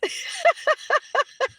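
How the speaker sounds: high, tittering laugh; real laughter. Real, not acted. Streamer amused by her own switching accent, real laughter. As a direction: giggle helplessly at yourself.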